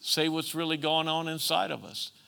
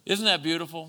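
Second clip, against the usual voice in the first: normal talking voice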